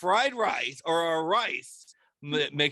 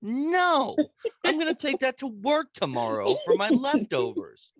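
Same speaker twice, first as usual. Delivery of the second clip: mock outraged no; 8 semitones higher than usual. Retelling dad taking his leftovers, high outraged protest. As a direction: squawk a shocked no, then argue. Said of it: "And I'm like".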